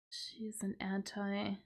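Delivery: unvoiced whisper of an example; soft whispered aside. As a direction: breathe it out as a whisper.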